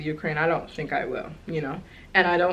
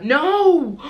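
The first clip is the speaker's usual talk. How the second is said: shocked no with gasp; 9 semitones higher than usual. Real, not acted. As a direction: cry out at her own slip, then gasp. Catching her own slip, shocked.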